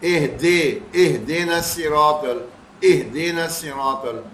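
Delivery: "اہدینا" recited as "eh-day-na", lax vowels; "اہدے" with "eh-day" for "ih-dee"; majhool zer. Shown as a demonstration. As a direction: show the mistake: "eh" and "day" in "اہدینا". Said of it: "اہ کوئی چیز نہیں ہے".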